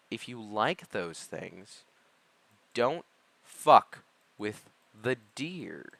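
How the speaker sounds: slow, word by word with pauses; deliberate warning. Pretend. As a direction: space out every word for emphasis. Punchline warning after a pro tip.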